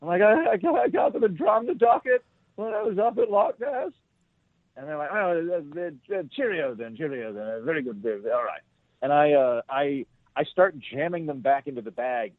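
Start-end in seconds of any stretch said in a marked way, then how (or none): 0.2-3.9 mock British. Answering soldiers, mimicking their accent. 5.1-8.6 polite British goodbye. Retold or imitated. Soldiers politely wrapping up.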